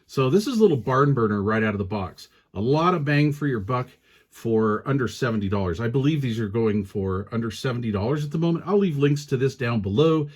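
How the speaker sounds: ordinary talk, nothing marked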